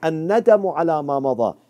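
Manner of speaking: rapid, recited Arabic "الندم على ما مضى"; Arabic recitation. Shown as a demonstration. Preacher quoting the first condition of repentance, fast Arabic. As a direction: rattle off "الندم على ما مضى" like a memorized text. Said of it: "Number one".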